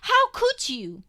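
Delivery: very high, loud, slow cry; anguished cry. A narrator's reading. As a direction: cry it out in pain. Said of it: "I gabble angrily between tears".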